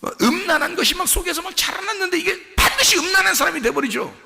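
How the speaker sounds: high-pitched, urgent sermon delivery; impassioned preaching. Real, not acted. Preacher warning the congregation about judgement, impassioned preaching. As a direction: preach it with rising fervour.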